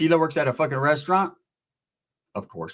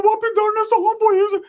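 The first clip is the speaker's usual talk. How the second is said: way up high and squeaky; mock him in a tiny high voice; pinched high mock of the cousin; high squeaky Rick voice; 5 dB louder than usual.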